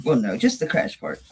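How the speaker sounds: normal talking voice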